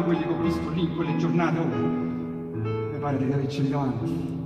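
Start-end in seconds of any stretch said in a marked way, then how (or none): none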